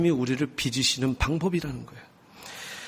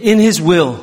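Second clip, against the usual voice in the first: slow and louder, each word drawn out; said loudly; 7 dB louder, much slower than usual. Shown as a demonstration. Pastor leading the congregation, slow and loud. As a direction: boom it out for the congregation to copy. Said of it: "자, 크게".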